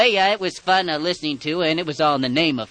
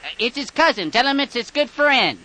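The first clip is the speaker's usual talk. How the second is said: higher, louder insistent caller voice; pushy prank caller; 10 semitones higher, 5 dB louder than usual. Acted. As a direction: push him to accept the call. Prank caller pushing his victim.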